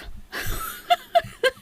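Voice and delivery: shrill giggle burst; high squealing laugh. Real, not acted.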